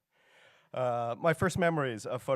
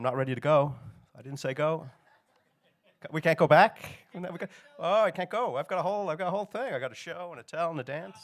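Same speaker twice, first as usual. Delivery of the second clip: flustered protest; 6 semitones higher, 6 dB louder than usual. Real, not acted. Panicked at slides starting early.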